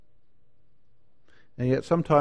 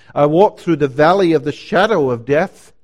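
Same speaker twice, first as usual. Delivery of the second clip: louder, ringing scripture declaration; declamatory preaching; 3 semitones higher, 9 dB louder than usual. Acted. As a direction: proclaim the verse with force.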